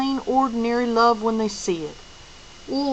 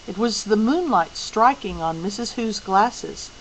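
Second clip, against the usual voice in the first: usual speaking voice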